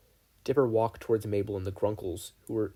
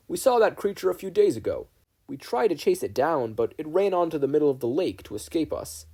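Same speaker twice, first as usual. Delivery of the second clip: a much higher, louder hearty voice; higher, louder Manotaur; 6 semitones higher, 5 dB louder than usual. Acted. Manotaur tells the boys about the pig, loud and hearty. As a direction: boom out the news cheerfully.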